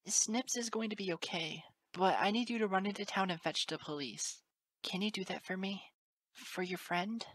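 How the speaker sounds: high, reassuring teacher voice; gentle urging. A narrator's reading. Teacher sending colt for police, gentle high urging. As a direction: coax a scared child into helping.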